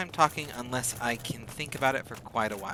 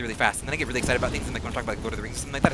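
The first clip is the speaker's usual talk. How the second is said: normal talking voice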